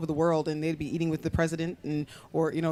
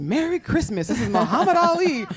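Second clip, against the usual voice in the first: high, loud, jubilant announcement; big showman's phone greeting; booming prank-call greeting; 10 semitones higher, 6 dB louder than usual. Retold or imitated. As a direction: announce yourself grandly to a startled stranger. Father's surprise calls to strangers.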